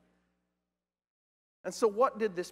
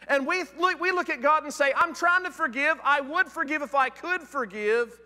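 mock pleading excuse; 6 semitones higher, 4 dB louder than usual. Preacher mimics excuse-making.

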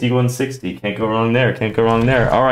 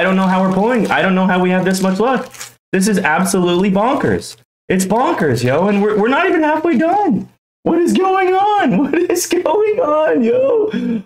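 amazed excitement; 8 semitones higher than usual. Real, not acted. Collector baffled by so much luck with packs left, giddy amazement. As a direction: pitch climbing into a high squeal. Amazed at repeated lucky pulls.

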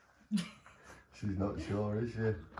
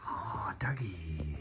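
whisper; 12 dB quieter than usual. Real, not acted. Softly calling the hidden dog.

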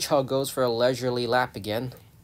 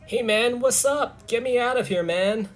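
high-pitched American hedgehog voice; American accent; 9 semitones higher than usual. Acted. Hedgehog asking to get out, in an American accent. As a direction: put on a much higher American accent. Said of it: "Is a hedgehog more American?".

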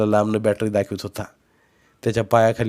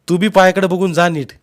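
loud, higher advice; shouted caution; 8 semitones higher, 7 dB louder than usual. A narrator's reading. Relative telling boy to watch his step, shouting. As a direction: call a reminder after someone. Warning called across stream.

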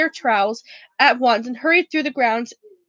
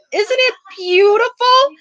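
higher, slow, doting Hagrid voice; Hagrid, muttered; 9 semitones higher, 4 dB louder, slower than usual. A narrator's reading. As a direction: coo it slowly over the newborn dragon. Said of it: "Hagrid muttered".

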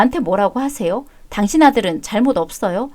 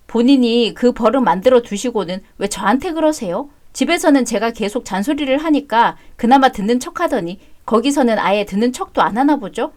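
ordinary delivery

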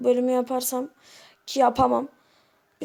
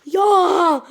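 sudden loud yell of frustration; frustrated shout; 6 semitones higher, 8 dB louder than usual. Real, not acted. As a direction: blurt it out in an angry yell. Raging at a game death.

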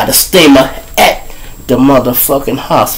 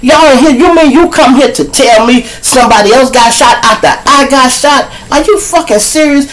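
furious outburst; 11 semitones higher than usual. Retold or imitated. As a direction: snap at him in disbelief. Retold angry outburst at police.

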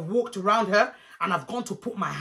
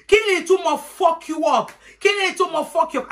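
high, raised-voice disbelief; outraged auntie; 6 semitones higher, 4 dB louder than usual. Retold or imitated. Auntie demanding what the threat means, high outraged voice. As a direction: imitate a scandalised auntie demanding meaning.

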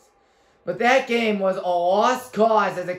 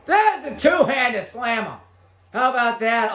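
raised-pitch commentator's shout; excited dunk call; 4 semitones higher than usual. Real, not acted. Gamer calling his two-handed slam, excited. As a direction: call the slam with a burst of energy.

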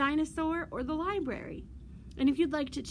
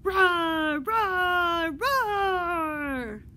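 three loud, higher roars in a row; dinosaur roaring; 4 semitones higher, 9 dB louder, much slower than usual. Acted. Librarian as dinosaur inviting children to join, roaring. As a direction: roar over and over like a storybook dinosaur. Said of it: "Come roar with me".

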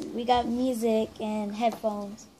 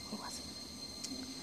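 whisper; 19 dB quieter, much slower than usual. Real, not acted. Whispering mid-thought.

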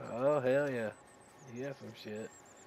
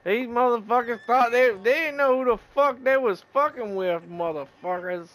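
excited; 8 semitones higher, 6 dB louder than usual. Real, not acted. Gloating over killed enemies.

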